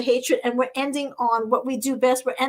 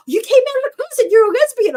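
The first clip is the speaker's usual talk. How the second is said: high, loud imitation of a thrilled woman; excited squeal; 12 semitones higher, 8 dB louder than usual. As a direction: squeal with delight at the news. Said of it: "You could have heard her squeal".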